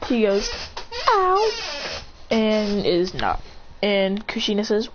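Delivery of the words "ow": high yelp of pain. Acted. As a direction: yelp on landing. Landing hard at home.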